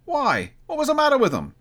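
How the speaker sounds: very high, nasal-free thin voice; third merchant. A narrator's reading. Snuff-taking merchant asking, high voice. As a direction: pipe up with the question. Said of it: "asked a third".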